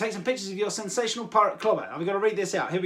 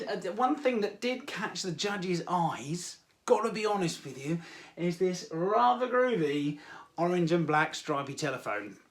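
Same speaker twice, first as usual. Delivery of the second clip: normal talking voice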